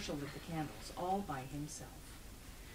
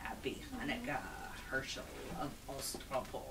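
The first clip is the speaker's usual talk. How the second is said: eerie, lowered monster voice; cracking-bones voice; 2 semitones lower, 4 dB quieter than usual. A narrator's reading. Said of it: "a voice that sounded like the cracking of bones".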